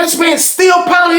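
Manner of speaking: high-pitched disbelief, drawn out; amazed exclamation. Retold or imitated. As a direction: retell it in high, stretched astonishment. Retold amazement at what he saw.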